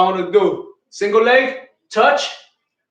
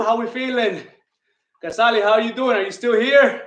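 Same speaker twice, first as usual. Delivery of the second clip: usual speaking voice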